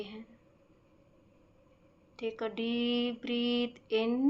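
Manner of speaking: very slow, measured meditation voice with stretched-out words and long pauses; guided breathing meditation. Acted. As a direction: guide them slowly through each breath. (धीमी, शांत ध्यान कराने वाली आवाज़)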